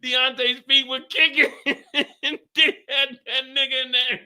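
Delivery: high, laughing through the words; can barely talk for laughing. Real, not acted. Telling chat about the kicking feet while cracking up.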